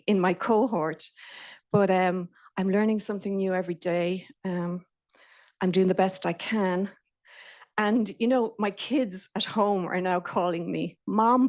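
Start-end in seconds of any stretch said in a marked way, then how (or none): none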